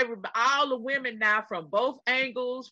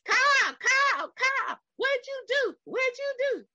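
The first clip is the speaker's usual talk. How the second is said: high, wailing cries of Kyle; acted screaming; 13 semitones higher than usual. Showing off acting skills to listeners, high wailing. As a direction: ham up a panicked woman. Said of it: "That's my acting skills".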